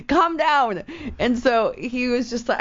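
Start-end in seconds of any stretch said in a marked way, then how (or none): 0.0-0.8 high, laughing "calm down"; half-laughing, pitched up; laughing plea. Retold or imitated. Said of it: "And I'm like".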